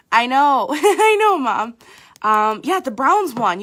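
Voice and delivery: loud, laughing excitement; excited shout. Real, not acted. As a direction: burst out thrilled about the win. Excited about the Browns win.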